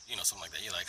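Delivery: ordinary talk, nothing marked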